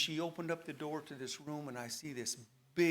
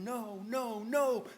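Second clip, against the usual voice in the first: desperate no, rising higher to a louder last no; retold scream; 7 semitones higher, 3 dB louder, much slower than usual. The prisoner screaming as he is pulled into hell, desperate. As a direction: plead as if being dragged away. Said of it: "and I'm screaming".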